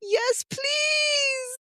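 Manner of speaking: very high, drawn-out squeal of support; excited cheer. Real, not acted. Host cheering on the guest's Sundance dream, excited. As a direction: cheer them on at the top of your range.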